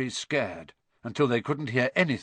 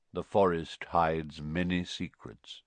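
low, measured centaur voice; deep-pitched, enigmatic reply; Ronan; 6 semitones lower than usual. A narrator's reading. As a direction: intone it low like a cryptic centaur. Ronan the centaur answers Hagrid.

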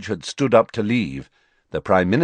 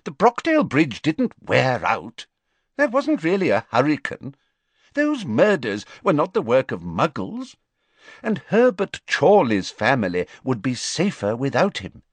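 high, fussy official voice; a busy minister brushing it off; Fudge's voice; 5 semitones higher than usual. Acted. Fudge tells the Prime Minister about Dumbledore in a high fussy voice.

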